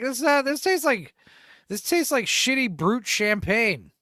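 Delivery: deadpan put-down of the fancy drink; hammed-up unimpressed diner; blunt honest guest. Blunt guest mocks the fancy water.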